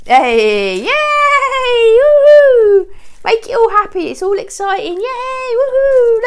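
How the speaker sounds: very high, loud party cheer; excited cheering. Real, not acted. Owner cheers to her dogs after a pop, high loud whoops. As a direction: whoop it up to make the bang fun.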